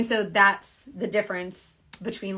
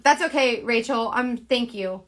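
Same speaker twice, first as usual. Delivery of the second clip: ordinary delivery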